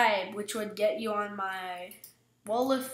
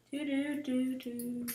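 usual speaking voice

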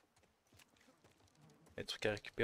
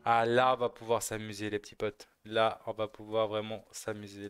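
ordinary talk, nothing marked

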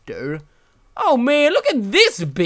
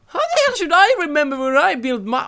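exasperated; 9 semitones higher, faster than usual. Real, not acted. Frustrated lost player.

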